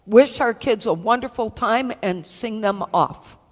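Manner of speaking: ordinary delivery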